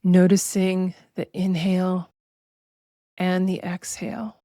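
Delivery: slow, measured meditation voice with long pauses; drawn-out, even-toned relaxation guidance; guided meditation. Acted. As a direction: guide listeners unhurriedly into body awareness.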